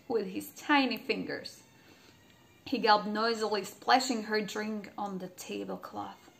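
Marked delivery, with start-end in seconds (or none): none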